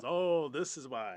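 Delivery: loud, high, drawn-out reply; eager storyteller. Acted. Storyteller answering child's question, eagerly launching in. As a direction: launch grandly into an explanation.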